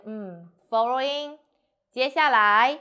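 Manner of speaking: slow, loud announcing 接下来, then stop; pause for attention. Acted. Leader making a speech, pausing after a loud 接下来. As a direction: announce 接下来 and leave it hanging. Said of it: "So they have a pause".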